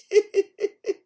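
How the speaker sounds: high tittering laugh; sly chuckle. A narrator's reading. Fox secretly laughs at the bear, high tittering. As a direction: titter in short bursts to yourself. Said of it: "he was laughing to himself".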